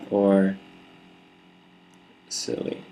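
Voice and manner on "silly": soft, whispered silly; whispered variation. Shown as a demonstration. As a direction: whisper the word under your breath. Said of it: "say the word silly in many different ways".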